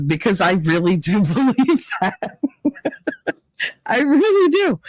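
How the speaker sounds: high-pitched laugh breaking into the words; real laughter through a sincere admission; laughing insistence. Real, not acted. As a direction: insist on it while cracking up. Laughing while insisting on own belief.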